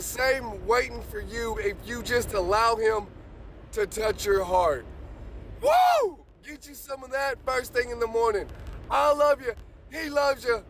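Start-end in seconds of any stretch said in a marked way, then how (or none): 5.6-6.2 high, loud joyful whoop; ecstatic whoop. Real, not acted. Morning preacher whooping for his viewers, ecstatic whoop. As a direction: let out a wild happy whoop. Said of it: "Man, I get excited about this".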